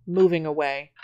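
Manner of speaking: usual speaking voice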